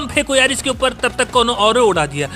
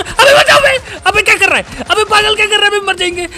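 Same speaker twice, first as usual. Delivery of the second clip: very high, loud frantic screaming; loud panicked yelling under fire; 8 semitones higher, 5 dB louder than usual. Real, not acted. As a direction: shriek for help, half laughing.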